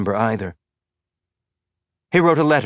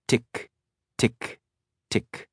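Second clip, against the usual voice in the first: fading ticks; 5 dB quieter, much slower than usual. A narrator's reading. Death clock ticking.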